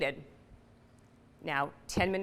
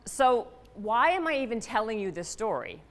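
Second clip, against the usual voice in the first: ordinary talk, nothing marked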